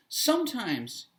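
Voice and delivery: ordinary talk, nothing marked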